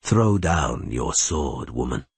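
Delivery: slow, low, composed order; unhurried, dropping low on the last word; calm voice. A narrator's reading. Elf rider demands Rayla's sword, calmly. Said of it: "in a calm voice".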